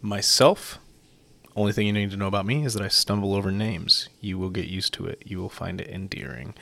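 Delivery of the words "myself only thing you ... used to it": ordinary delivery, nothing marked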